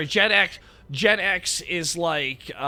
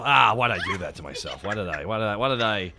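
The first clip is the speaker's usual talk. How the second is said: mock regret; 7 semitones lower than usual. Regretting agreeing to the show.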